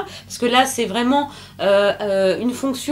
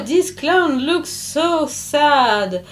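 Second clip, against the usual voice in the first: reading English aloud; 4 semitones higher, much slower than usual. Shown as a demonstration. Reads English line from book.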